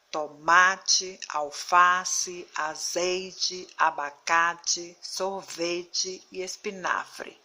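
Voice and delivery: toMAte, alFAce punched loud on the next-to-last syllable; forceful strong syllable. Shown as a demonstration. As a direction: stretch and hit the strong syllable in Tomate, abacate, sorvete. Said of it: "a sílaba mais forte foi pronunciada com mais força". (Tomate, alface, espinafre pausadamente, com força na penúltima sílaba)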